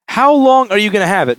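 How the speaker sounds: "how long" pitched high and punched; incredulous quote. Retold or imitated. Repairer re-quoting the customer's question, incredulous stress on "how long".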